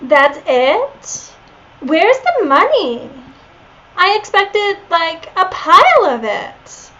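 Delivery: put-on high, disappointed Valley Girl. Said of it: "Leon is a Valley girl".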